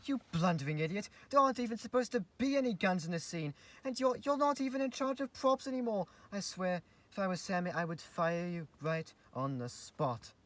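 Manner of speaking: high, loud scolding rant. Acted. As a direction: berate the clumsy assistant. Scolding the assistant.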